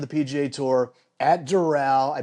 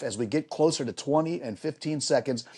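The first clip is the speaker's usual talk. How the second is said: normal talking voice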